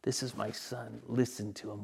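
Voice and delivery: awed, quiet repeat of the heavenly words; hushed echo. Acted. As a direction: let it fall to a reverent hush. Same words echoed back, hushed.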